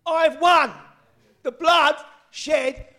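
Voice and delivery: high, loud triumphant preaching, easing in volume at the end; shouting preacher. Real, not acted. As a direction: shout out the victory, drawing out the words. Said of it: "Sorry, I'm shouting".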